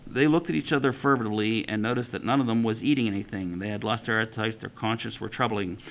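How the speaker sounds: steady read-aloud storytelling voice; story reading. A narrator's reading. Teacher reading a midrash to the class, storytelling.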